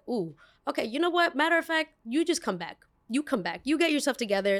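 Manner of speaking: high, lively mock professor voice; imitating professor. Professor telling a frazzled student to come back tomorrow, imitated high. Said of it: "my professor was like".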